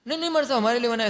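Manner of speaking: high, quick eager protest to the monk; eager denial. Retold or imitated. Devotee eagerly denying to the monk, high and quick. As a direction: blurt out the wrong answer eagerly. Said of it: "ऐसा बोलना ही नहीं बोलना".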